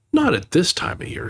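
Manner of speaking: a hint of a simple bear; mild bear voice; Pooh voice. Pooh's line.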